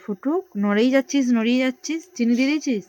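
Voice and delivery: loud call to her son. Real, not acted. Mother calls out to son.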